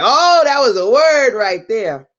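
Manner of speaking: high, loud, drawn-out retold amazement; impressed exclamation. Client reacting to a video, imitated impressed exclamation. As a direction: exclaim it slowly like an awed client.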